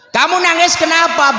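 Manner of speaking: high-pitched concerned question; acted worried grandpa; questioning grandfather. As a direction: ask a crying child what is wrong. Grandfather questions a crying child.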